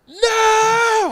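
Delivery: long, high screamed cry of despair; full-throated, drawn-out high yell; screaming. Retold or imitated. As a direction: scream it out at the top of your lungs. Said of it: "I'm just screaming at the top of my lungs".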